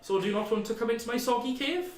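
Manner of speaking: lightly voiced chatty hermit; a hint of a cheery cave dweller.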